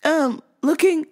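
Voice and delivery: high, halting confused customer. Retold or imitated. Customer asking the clerk for the vitamin man, high and confused.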